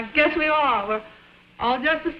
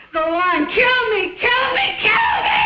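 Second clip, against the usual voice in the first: screams climbing higher each time; hysterical screaming; 12 semitones higher, 4 dB louder than usual. Acted. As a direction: scream louder and louder. Woman screaming, building.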